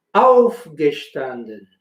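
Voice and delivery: Aufgestanden, first syllable auf strong, rest falling; stress on auf. Shown as a demonstration. Teacher shows learners the stress on auf, slowly. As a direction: hit auf, let gestanden fall away. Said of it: "se seguiría acentuando ahí la palabra".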